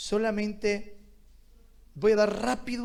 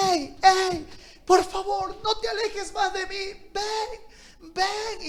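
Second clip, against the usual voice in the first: God calling from afar; 9 semitones higher, slower than usual. Acted. God calls the faithful who drift away, pleading. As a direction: call the stray child back, pleading, then hush to a coax. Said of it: "Dios te dice".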